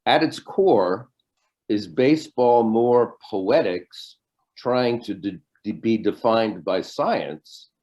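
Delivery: slow, measured reading with pauses; deliberate question reading. Real, not acted. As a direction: pose it slowly, leaving room to think. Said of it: "give you a little time to think".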